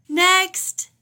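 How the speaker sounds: high, loud call to the waiting room. A narrator's reading. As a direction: call the next patient in. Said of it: "called Doctor Grizzly".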